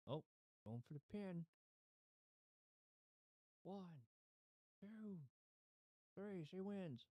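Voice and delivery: high, rising referee count; excited pin count. Real, not acted. Counting a wrestling pin in game.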